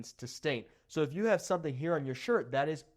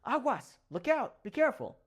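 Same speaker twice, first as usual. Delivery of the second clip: urgent warning shout; 8 semitones higher than usual. Shown as a demonstration. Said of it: "a car's coming really fast".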